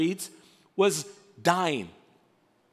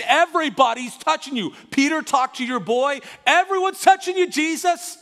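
exasperated father, loud and high; impatient Jairus; 7 semitones higher, 5 dB louder than usual. Acted. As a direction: build to a high, urgent protest. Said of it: "like, impatient father moment".

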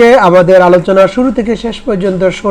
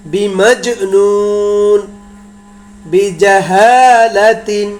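slow word-by-word Arabic chanting; Qur'anic recitation drill; much slower than usual. Shown as a demonstration. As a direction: hold the long vowels, pause between words. Recites Arabic example words. (ধীরে টেনে আরবি মশক)

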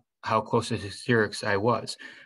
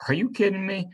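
much higher, startled question; stunned disbelief; 10 semitones higher, faster than usual. A narrator's reading. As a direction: blurt it out in shock. Stranger stunned by the confession.

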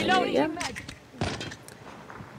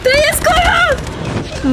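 drawn-out high yell; 12 semitones higher, much slower than usual. Real, not acted. Gamer alarmed at shielded enemy.

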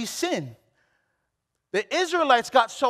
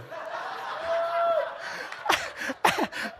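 high, breathy burst of laughter; high laughing burst; 7 dB quieter than usual. Real, not acted. Preacher laughing at his own joke to the congregation.

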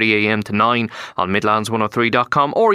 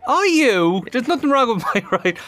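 high-pitched playful outrage; mock indignation; 10 semitones higher than usual. Writer defends his handwriting to teasing colleague, mock indignant. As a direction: protest as if mildly insulted. Teased about his scrawly writing.

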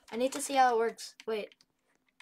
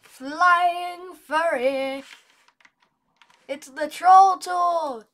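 excited; 4 semitones higher, 6 dB louder, much slower than usual. Real, not acted. Delighted at troll tools.

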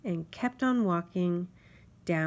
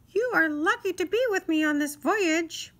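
high, louder self-important insect voice; boastful mosquito; 11 semitones higher, 6 dB louder than usual. Acted. Mosquito boasts to Grasshopper, high and proud. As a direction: brag like a tiny sea captain.

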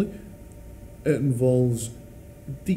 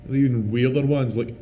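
ordinary talk, nothing marked

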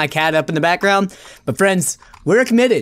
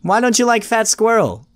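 ordinary talk, nothing marked

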